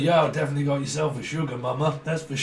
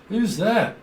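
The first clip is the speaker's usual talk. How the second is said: ordinary talk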